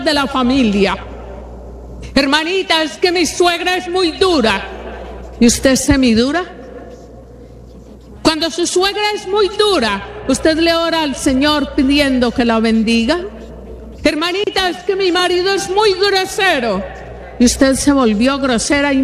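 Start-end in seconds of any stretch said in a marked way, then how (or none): 2.1-4.6 higher, pleading complaint to the pastor; whiny complaining congregant. Retold or imitated. A church sister complaining to the preacher about her mother-in-law, in a higher whiny voice. 14.0-16.8 higher, pleading complaint to the pastor; whiny complaining congregant. Retold or imitated. A church sister complaining to the preacher about her mother-in-law, in a higher whiny voice.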